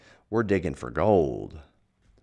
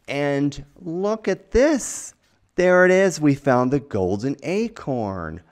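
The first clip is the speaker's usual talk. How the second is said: much higher, louder, thrilled cry; excited discovery; 9 semitones higher, 9 dB louder than usual. Real, not acted. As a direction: burst out with delight at the find. Finding the golden acorn.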